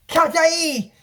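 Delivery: high ringing call. Retold or imitated. Princess calls out the name, high shout.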